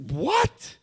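a high, loud mock shocked what; shocked mother. Mother hearing her son was a thief, mock shock. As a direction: imitate mom gasping in disbelief. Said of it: "My mother's like".

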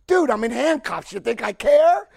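slow, loud scoffing retort; incredulous retort. Retold or imitated. Retelling arrest, retort to officer.

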